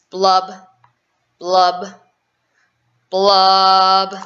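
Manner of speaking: slow, loud, hollow fish blubs. Acted. The gloomy fish blubbing his sorrow, drawn out. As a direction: let each blub swell and hang.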